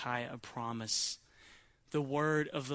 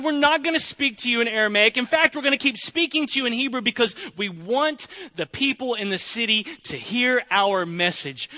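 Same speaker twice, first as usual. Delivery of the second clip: louder and higher, a boastful army herald's taunt; loud mocking threat; 7 semitones higher, 8 dB louder than usual. Assyrian commander shouts taunts at Jerusalem's wall, loud and mocking. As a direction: bellow the threat across the city wall. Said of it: "They said".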